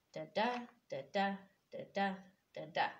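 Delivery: quick da-DA pairs, second da punched; unstressed-stressed beat. Shown as a demonstration. Teacher sounding out the meter, quick da-DA stress pattern. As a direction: tap out the iambic rhythm, da DA da DA. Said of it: "Unstressed, stressed".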